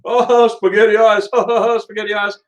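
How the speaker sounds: imitated high-pitched guffawing through the words; dying laughing. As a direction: act out cracking up as you tell a friend. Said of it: "dying laughing".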